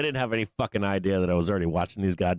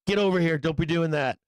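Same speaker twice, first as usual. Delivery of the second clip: calling the dog back; 6 semitones higher than usual. Retold or imitated. Owner calling his dog back from the coyotes, raised and scolding. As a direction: scold the dog from across the yard. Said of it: "I'd be like".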